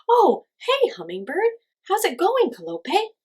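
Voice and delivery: high, loud happy greeting; glad greeting. A narrator's reading. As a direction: greet a friend with relief. Coyote greets friend.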